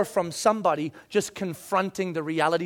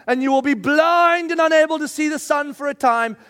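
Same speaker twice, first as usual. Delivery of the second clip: loud, high, fierce rebuke; booming prophetic condemnation; thundering rebuke; 7 semitones higher, 6 dB louder than usual. Acted. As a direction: pronounce the curse like a prophet. Paul cursing the sorcerer, read with force.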